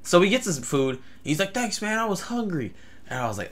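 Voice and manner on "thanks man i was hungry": higher-pitched crazy stranger; drugged stranger. Retold or imitated. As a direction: play the man on drugs, pitched up. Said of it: "either he was crazy or he was on".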